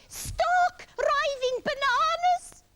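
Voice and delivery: high, slow, exasperated yell; Iggy yelling. A narrator's reading. Iggy maddened by the itch, exasperated yell. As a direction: yell it, stretching every word. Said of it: "Iggy yelled".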